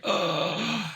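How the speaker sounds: high, drawn-out "ugh"; disgusted groan. Retold or imitated. Players groaning at the troll's gory kill, disgusted. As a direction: recoil with a long, high groan. Group recoils at gory description.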